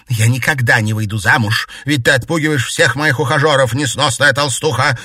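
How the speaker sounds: loud, high, indignant outburst; angry bark. A narrator's reading. Mistress blaming maid for lost suitors, barking. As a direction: lash out at the insolent maid. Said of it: "рявкнула на неё Алёна Александровна".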